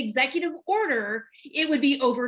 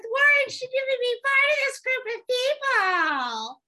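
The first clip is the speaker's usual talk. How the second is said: mocking whine; 11 semitones higher than usual. Host mimicking listener feedback about the show, mocking whine. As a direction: whine it way up high in mock outrage.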